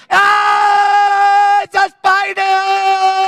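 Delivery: full-force high panicked scream, drawn out; maniac scream. Acted. Mother finds baby holding a spider, maniac scream. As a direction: shriek like a mother terrified of a spider. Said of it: "screaming like a freaking maniac".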